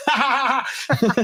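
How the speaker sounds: a forced ha ha ha laugh; imitating a nervous laugh; fake laugh. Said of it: "you just go".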